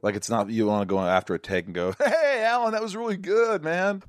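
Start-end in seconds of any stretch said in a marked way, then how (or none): 2.0-4.0 high-pitched mock gushing praise; put-on high, drawn-out fawning voice; gushing admirer. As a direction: play an over-eager fan, voice way up high. Acting a gushing co-star after a take.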